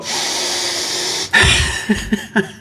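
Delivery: breathless high-pitched unvoiced laugh; exasperated high laugh. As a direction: laugh it off in disbelief. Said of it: "saw me go".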